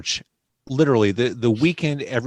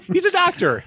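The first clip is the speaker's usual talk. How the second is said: much higher, astonished punchline; amazed exclamation; 3 dB louder than usual. Retold or imitated. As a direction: blurt it out in disbelief. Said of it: "I'm like".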